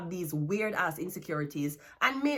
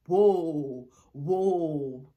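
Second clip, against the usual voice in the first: mock startled whoa; 3 semitones lower, much slower than usual. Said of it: "Then them finally say".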